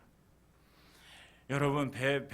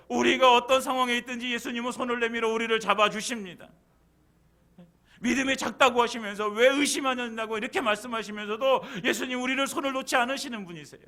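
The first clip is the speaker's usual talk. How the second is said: loud, high, full-force sermon delivery; impassioned preaching; 10 semitones higher, 7 dB louder than usual. Real, not acted. Preacher assuring congregation Jesus holds their hand, impassioned and loud. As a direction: drive it home to the congregation at full voice.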